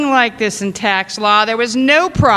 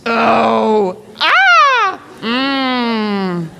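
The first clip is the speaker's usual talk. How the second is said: moans in various tones; much slower than usual. Shown as a demonstration. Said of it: "I moaned in various tones".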